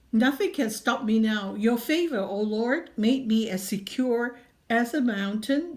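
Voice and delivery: ordinary talk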